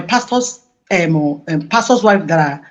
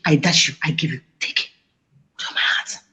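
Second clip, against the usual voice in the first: whispered offer; 6 semitones lower, 8 dB quieter, faster than usual. Retold or imitated. Pastor's wife mimics giving gift.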